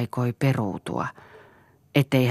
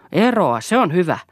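raised, loud angry outburst; roaring; 6 semitones higher, 6 dB louder than usual. A narrator's reading. Father roaring at son about quitting school, angry. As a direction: throw it back angrily, loud. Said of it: "ärjäsi Eero".

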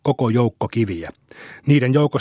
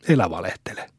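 lower, dismissive don't-lie jab; scornful retort; 5 semitones lower, 3 dB quieter than usual. A narrator's reading. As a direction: cut him down flatly. Boy accusing friend of lying. (halveksiva tokaisu)

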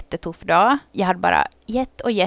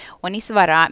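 normal talking voice

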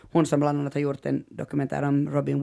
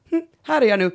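high-pitched imitation, a little louder; unrepentant self-announcement; 9 semitones higher, 5 dB louder, faster than usual. Storyteller voicing a regretless Lance, unapologetic imitation. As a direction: imitate someone presenting himself without regret. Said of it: "Han är bara att så här".

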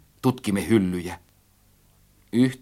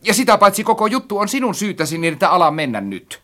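high, loud blame; quarrelsome ordering; 9 semitones higher, 4 dB louder than usual. A narrator's reading. Narrator blames friend for everything, quarrelsome. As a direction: scold and order them up the ladder.

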